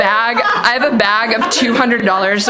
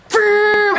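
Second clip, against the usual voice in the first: high playful engine noise vroom; mock engine vroom; 12 semitones higher than usual. Uncle speeding up the car, high mock engine vroom. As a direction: toss off a high engine sound.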